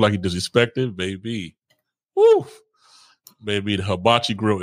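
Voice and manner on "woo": a sudden high squealed woo. Acted. Hyping up a lover's anger, high squealed woo. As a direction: whoop it up high like the grill just lit. Hibachi grill heating up.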